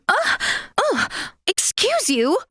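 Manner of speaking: high, startled huffs and a prim rebuke; affronted. Acted. Refined character rebuking someone's bad manners, affronted. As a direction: act taken aback by rudeness.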